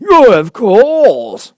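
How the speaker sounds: high, loud, very slow and solemn; grave Dodo. Acted. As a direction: pronounce it with grand gravity. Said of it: "the Dodo replied very gravely".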